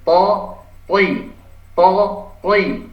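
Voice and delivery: "Po, poi" as thick emphatic Arabic T; heavy tha, thi. Shown as a demonstration. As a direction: lift the back of the tongue for heavy "Po, poi". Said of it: "punggung lidahnya merapat ke langit-langit".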